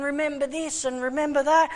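a hint of a mocking devil; devil taunting. The devil taunting the preacher with his past, mock accusing. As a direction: taunt it, pushing and needling. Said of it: "And he's going".

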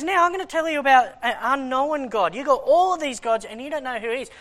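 ringing preacher's declaration; bold proclamation. Retold or imitated. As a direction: proclaim it to the crowd like Paul. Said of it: "and he goes".

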